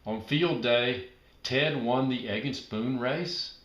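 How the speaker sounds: usual speaking voice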